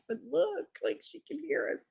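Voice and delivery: high, soft mock-worried voice; hushed warning. Warning boyfriend his mother can hear, hushed. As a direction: warn quietly as if someone might overhear.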